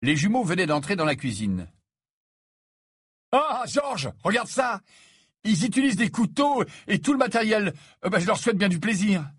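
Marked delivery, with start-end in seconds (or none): 3.3-9.3 high-pitched teasing wonder; mock amazement. A narrator's reading. Fred teases the boys peeling by hand, in mock amazement. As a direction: marvel theatrically, to needle them.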